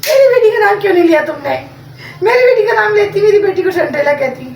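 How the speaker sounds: loud, high-pitched mimicked complaint; imitated angry outburst. Retelling the elder daughter's complaint at the house, mock furious. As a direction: re-enact a foot-stamping tantrum, loud and high. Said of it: "बड़ी बेटी ने आके घर पे टांगे पटकी हैं".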